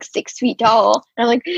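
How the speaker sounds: ordinary talk, nothing marked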